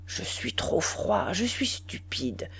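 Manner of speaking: slow unvoiced whisper; slow whispered self-reproach; inner thought. A narrator's reading. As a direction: whisper it slowly like thinking aloud. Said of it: "Il songeait".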